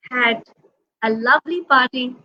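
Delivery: ordinary delivery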